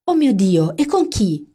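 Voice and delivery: high loud excited squeal; shrieking. A narrator's reading. The two friends shrieking at Amanda's engagement news. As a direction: squeal it in shock. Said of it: "urlammo in simultanea".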